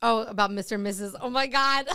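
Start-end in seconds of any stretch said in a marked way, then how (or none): 1.2-2.0 laughing. Real, not acted. Laughing at forgetting the topic.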